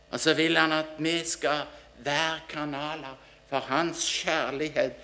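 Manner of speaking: normal talking voice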